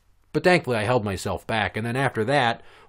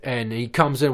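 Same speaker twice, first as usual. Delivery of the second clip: ordinary talk, nothing marked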